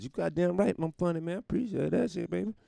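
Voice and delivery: mock cocky reply. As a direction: preen like a flattered comic. Fan praise, his own reply.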